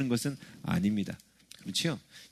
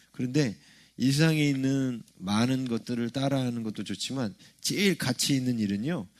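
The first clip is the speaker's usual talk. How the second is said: ordinary talk, nothing marked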